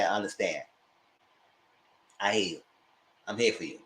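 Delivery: low, soft, gentle reassurance; reassuring. Acted. Listener to someone confiding their story, gently reassuring.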